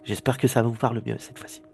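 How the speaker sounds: ordinary talk, nothing marked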